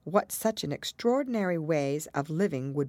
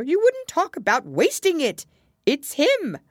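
pompous Hatter; 13 semitones higher, 9 dB louder than usual. A narrator's reading. Hatter lecturing Alice on knowing Time, pompous.

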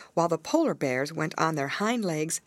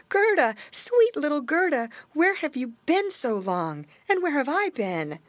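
shouted; 10 semitones higher than usual. A narrator's reading. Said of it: "and he shouted".

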